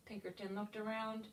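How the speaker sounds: ordinary delivery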